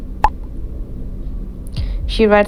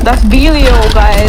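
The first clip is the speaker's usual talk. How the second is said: loud, raised excited call; alarmed recognition; 2 semitones higher, 7 dB louder, slower than usual. Real, not acted. Gamer telling viewers the villain is there, loud alarmed call. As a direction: blurt it out to the viewers.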